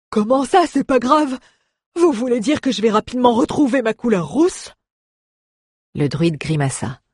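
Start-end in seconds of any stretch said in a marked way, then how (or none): 0.1-4.7 high, loud worried protest; alarmed dwarf. Acted. Dwarf protests to the druid, alarmed. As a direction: demand an answer in alarm.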